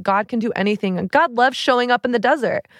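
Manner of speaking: ordinary talk, nothing marked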